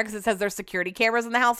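ordinary delivery

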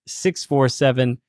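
ordinary delivery

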